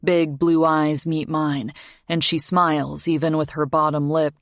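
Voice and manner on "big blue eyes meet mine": usual speaking voice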